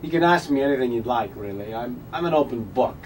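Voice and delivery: deadpan Walken cadence; Christopher Walken impression. Continues Walken bit.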